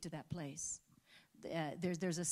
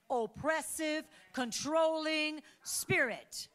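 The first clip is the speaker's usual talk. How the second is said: louder, stirring sermon voice; rousing preaching; 6 semitones higher, 9 dB louder than usual. Acted. Pastor calling the church to stand and pray, rousing. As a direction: lift them to their feet.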